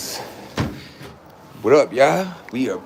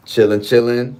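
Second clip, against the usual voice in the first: ordinary delivery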